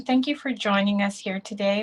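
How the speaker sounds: usual speaking voice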